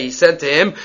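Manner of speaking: ordinary talk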